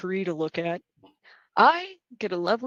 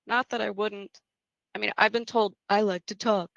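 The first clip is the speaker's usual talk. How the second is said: ordinary talk, nothing marked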